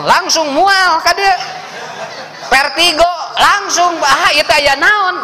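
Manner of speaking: high, slow hammed-up queasy groan; mock nauseated spouse. Spouse feeling sick at the sight of partner, hammed-up. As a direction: play the sick spouse for laughs.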